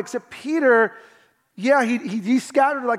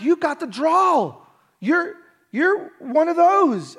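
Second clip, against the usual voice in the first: accusing bystanders; 8 semitones higher, 3 dB louder, slower than usual. Retold or imitated. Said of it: "so, like".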